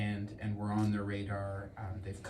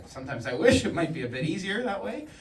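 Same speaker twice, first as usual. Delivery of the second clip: laughing; 9 semitones higher, 8 dB louder than usual. Real, not acted. Joking about winks and nudges.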